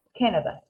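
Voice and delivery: "Canada" starting almost like "Ken"; English Canada. Shown as a demonstration. Teacher repeats the English name for Gladys. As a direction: open with an E-like vowel. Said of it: "se pronuncia casi como una E".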